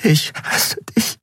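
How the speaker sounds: slow, breaking whisper near tears; halting, tearful whisper. A narrator's reading. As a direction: whisper it slowly, starting to cry. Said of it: "Dann begann sie zu weinen".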